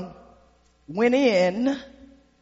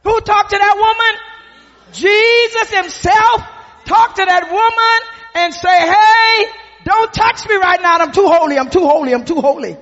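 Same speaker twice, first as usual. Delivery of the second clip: rising, louder preacher's cadence; preaching at full force; 8 semitones higher, 6 dB louder than usual. Acted. Preacher to the congregation, rising loud cadence. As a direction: build it up from the pulpit.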